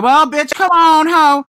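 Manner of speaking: high, loud and drawn-out call; brash mock shout. Little sister dressed to marry the groom, brash mock shout. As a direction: belt it out like a sassy bride. Acting out the replacement bride.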